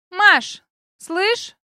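high, loud, mannered drawl; affected girl voice. A narrator's reading. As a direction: put on airs, girlish and showy. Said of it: "манерным голосом".

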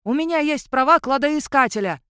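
громкий крик на бегу, выше обычного; вопи, убегая от погони; вопит на бегу. A narrator's reading. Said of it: "вопил он на бегу". (вопит на бегу, громко и высоко)

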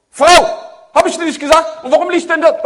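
loud, high mock yell at the wife; angry husband yelling. Husband barges home and shouts at his wife, hammed-up. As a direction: burst in the door scolding.

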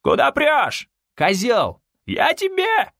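high, outraged cries; indignant high-pitched crowd shouts; angry yells. A narrator's reading. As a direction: yell abuse after someone shoving past. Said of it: "Следом нёсся шквал воплей".

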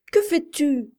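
high and loud, demanding; alarmed cry. A narrator's reading. Mother seeing her son ride wildly, crying out. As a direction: shout the question in shock. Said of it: "cria-t-elle".

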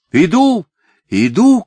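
high, loud answering call; hurried-to-answer high shout; shouting back. A narrator's reading. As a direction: call back across the distance. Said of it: "крикнула Софичка".

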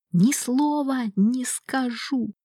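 strained, breathless whisper; pained whisper. Acted. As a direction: force it out through pain. Miklash gasps in pain. (хриплый шёпот от боли)

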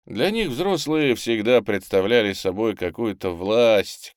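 ordinary delivery, nothing marked

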